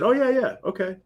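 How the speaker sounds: normal talking voice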